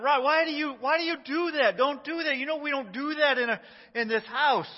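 mild mock scolding, pitched high; scolding yell. Dad scolds his son, a half-hearted retold yell. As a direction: replay a frustrated dad's yell, lightly. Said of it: "I was yelling at him".

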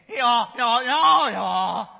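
loud laughing mock bray; donkey bray. Kids' donkey answering Balaam, loud laughing mock bray. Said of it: "You think that's what he said?".

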